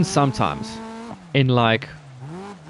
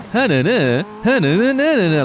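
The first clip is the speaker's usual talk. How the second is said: cliche sing-song; 7 semitones higher than usual. Retold or imitated. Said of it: "That kind of way of talking is so advertisey".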